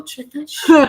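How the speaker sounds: loud, high hype shout; excited hype. Real, not acted. Co-host hyping the guest's outfit, loud and high.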